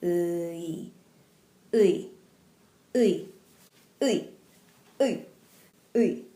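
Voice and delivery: 의 drawn out slowly, u gliding into e; slow pron demo. Shown as a demonstration. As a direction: glide 의 slowly from u to e. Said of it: "So slowly".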